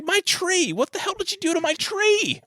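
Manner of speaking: high-pitched hammed-up yell at the tree; mock Clark outburst. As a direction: screech it like the dad who lost his tree. Clark yelling about his burnt tree.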